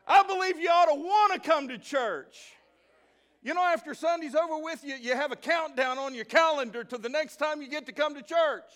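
loud, high preaching call to the church; rally the church, ringing out; preaching at full force. Real, not acted. Preaching with rising intensity.

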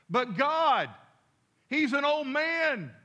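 high, loud protest, drawn-out ending; arguing. Retold or imitated. Teenage boy argues back at God, loud high protest. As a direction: protest loudly with a stretched-out excuse. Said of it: "He kinda argued".

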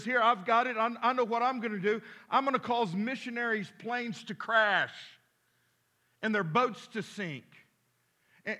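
loud, high gleeful demon; a devil shouting his big scheme; excited devil boasting. Acted. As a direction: crow it out like a proud devil. Third demon shouts his plan.

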